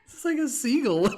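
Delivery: high, loud giggly remark; laughing. Real, not acted. Amused by her own laugh, giggling.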